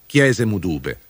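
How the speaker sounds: usual speaking voice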